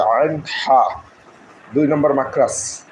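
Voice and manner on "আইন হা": আইন হা with the ain pushed from the middle of the throat; throat letter demo. Shown as a demonstration. Teacher showing students the throat-born ain of আইন হা. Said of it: "আইনকে গলার মাঝখান থেকে উচ্চারণ করতে হবে".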